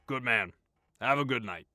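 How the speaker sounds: hearty farewell. A narrator's reading. Boss leaves cheerfully.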